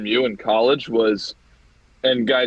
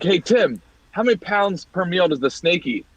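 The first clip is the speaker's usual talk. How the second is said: calling to roommate; 8 semitones higher than usual. Retold or imitated.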